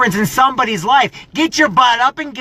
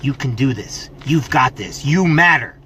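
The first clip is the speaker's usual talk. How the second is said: sincere and soft; 7 semitones lower, 4 dB quieter than usual. Real, not acted. Motivator turns quiet and sincere to his viewers. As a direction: lower and softer, close and earnest. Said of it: "one thing I'm gonna say differently".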